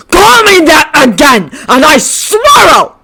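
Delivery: loud, high, slow shouted threat; furious threat. Acted. Bakugo lunging at Shinso, shouting a threat. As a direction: roar it as he lunges.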